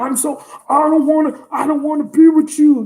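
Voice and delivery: exaggerated mock protest; mock pretense. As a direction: mimic someone putting on an act. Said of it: "they be trying to pretend".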